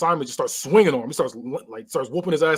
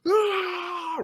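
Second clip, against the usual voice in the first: pained cry; 13 semitones higher than usual. Retold or imitated. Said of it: "he goes".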